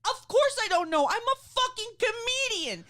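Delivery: high-pitched, hammed-up indignant rant; retold furious outburst. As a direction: rant in comic outrage at the doctor. Retold furious comeback to doctor.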